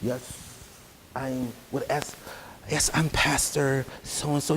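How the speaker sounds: hushed, slow, soft-spoken pastor voice; mock a polished pretty-boy preacher; quiet, slow, prim pulpit voice; soft eloquent preacher. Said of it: "they spoke real eloquent".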